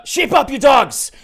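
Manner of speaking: very loud, high, drawn-out shout; full-voiced rallying yell; yelled command. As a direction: bellow it like a captain rallying the crew. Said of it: "so you hear him yell out".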